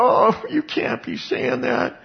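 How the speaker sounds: high, slow, scoffing disbelief; mock disbelief. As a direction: drag it out like you can't believe your ears. Religious leaders scoffing at Jesus.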